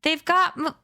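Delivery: high panicked cry cut off; boy gagged mid-scream, panicked. A narrator's reading. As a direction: yell until a hand stops you.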